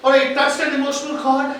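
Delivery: upbeat check-in with the audience; lively call-out. Real, not acted. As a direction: wake the room up. Host bursts in after film.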